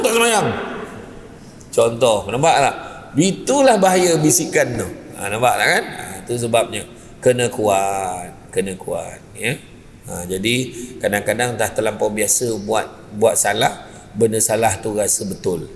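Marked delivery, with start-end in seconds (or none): none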